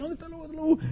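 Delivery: voz aguda y bajita de alarma actuada; papá sobresaltado. Acted. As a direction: pregunta apurado, como recién despertado. Papá alarmado busca al lobo. (papá alarmado, en voz aguda)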